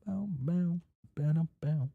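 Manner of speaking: high hummed bow bow like a movie sting; mock dramatic sting. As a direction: sing a mock ominous sting. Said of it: "dramatic sound effect".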